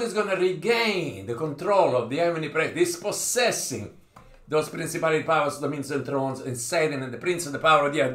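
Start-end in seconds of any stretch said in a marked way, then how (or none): none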